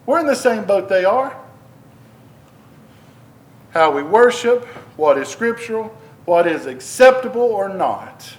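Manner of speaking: normal talking voice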